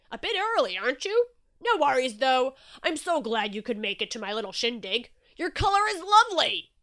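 loud, jovial party host at the door; hearty Shark. Acted. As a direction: boom out a jolly welcome. Shark continues greeting.

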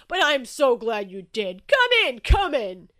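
booming shark host gushing over a gift; delighted Shark. Acted. Shark invites Inky in.

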